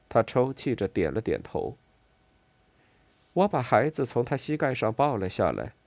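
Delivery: usual speaking voice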